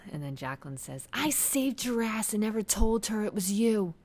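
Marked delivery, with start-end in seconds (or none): 1.1-3.9 high, loud, drawn-out accusation; snapping. Acted. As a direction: act out someone finally snapping. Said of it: "like, finally snaps".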